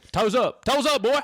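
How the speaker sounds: football coach drilling ballet dancers; yelling ballet coach. Retold or imitated. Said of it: "yelling at people".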